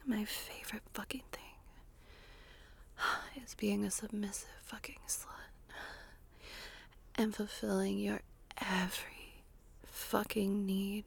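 breathy close-mic ASMR whisper; intimate whisper. Acted. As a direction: whisper it right into the listener's ear.

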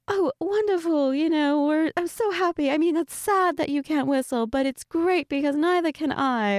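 high-pitched, gushing fake British accent; Peppa Pig. Acting out Peppa's delight for a teasing friend, mock British accent. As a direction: gush in a hammed-up British cartoon voice. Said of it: "I'm bad at the accent".

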